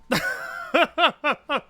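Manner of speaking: high staccato chuckle; laughing. Real, not acted. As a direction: laugh in a short run of quick bursts. Amused by own justification.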